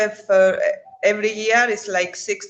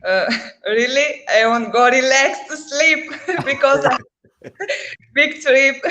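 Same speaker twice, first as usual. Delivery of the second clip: giggling between the words; laughing; 5 semitones higher, 3 dB louder than usual. Real, not acted. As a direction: laugh while you answer. Laughing through her answer.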